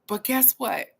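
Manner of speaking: ordinary delivery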